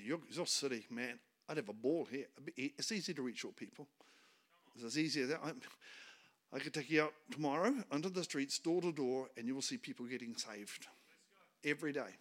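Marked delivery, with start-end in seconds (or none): none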